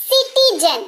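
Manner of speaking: teacher pitches सिटीजन up for students; pronunciation demo. Shown as a demonstration. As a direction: present सिटीजन as the model word. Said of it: "उच्चारण समझिए".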